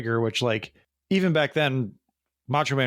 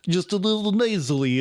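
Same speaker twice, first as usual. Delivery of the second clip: imitating a generic Eastern European accent; a put-on movie Russian voice; mock Russian accent; 6 semitones higher than usual. Mocking actors' generic Russian accent, imitated.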